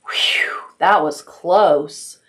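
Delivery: breathless, slow relief; relieved sputter. Acted. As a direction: puff out relief after the escape. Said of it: "he sputtered".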